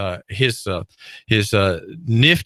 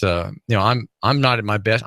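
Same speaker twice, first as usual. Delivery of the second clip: usual speaking voice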